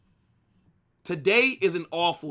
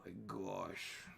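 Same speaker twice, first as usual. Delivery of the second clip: despairing groan; 8 semitones lower, 18 dB quieter, much slower than usual. Real, not acted. Sighing despair after loss.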